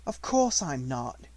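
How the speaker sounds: slow, gentle reassurance; calm her down; reassuring. Acted. Sarah reassures Becky.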